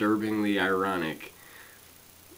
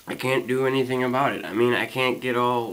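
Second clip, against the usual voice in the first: usual speaking voice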